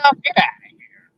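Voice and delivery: high-pitched scoffing disbelief; incredulous dismissal; retold disbelief. Retold disbelief at Bigfoot claim.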